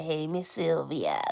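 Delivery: lightly voiced man's greeting, slow and soft; a hint of his slow drawled hello; imitating him. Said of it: "That's the way he says it".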